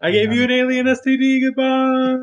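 high singsong goodbye, held on one pitch; mock farewell.

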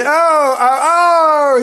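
high, loud mock groans of pain; long hammed-up agonised moans; terrible groan. A narrator's reading. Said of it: "and groaned terribly".

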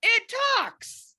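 high, loud astonished cry; amazed exclamation. Retold or imitated. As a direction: gasp it out like you just heard a miracle. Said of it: "he picked up the other end and went".

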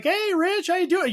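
high-pitched, eager hello to a mate; retold friendly greeting. Greeting a man he mistook for Rich, high and chummy. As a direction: greet him like an old pal, pitched well up.

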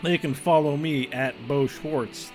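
ordinary talk, nothing marked

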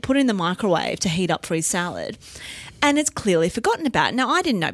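ordinary talk, nothing marked

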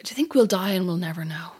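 hushed whisper of a creeping fear; whispered worry. Retold or imitated. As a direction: whisper it like a secret dread. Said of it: "I'm like".

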